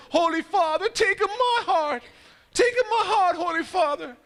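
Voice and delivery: high, stretched pleading yell. Acted. Two men yelling up to the Pope, high pleading. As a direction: cry it up to the balcony, begging. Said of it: "he starts to yell out to the Pope".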